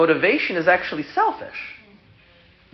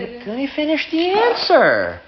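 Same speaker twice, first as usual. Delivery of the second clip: odd high voice. Real, not acted. Rabbi catching himself in a high voice, laughing. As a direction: slip into a high choirboy voice. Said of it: "I don't know why I'm talking like this".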